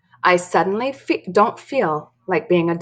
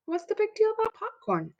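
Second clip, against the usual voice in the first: high little doll voice; 7 dB quieter than usual. Acted. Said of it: "asks Mary".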